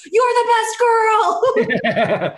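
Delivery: very high, slow, gushing fan voice; gushing excitement. Retold or imitated. Friend gushes, retold.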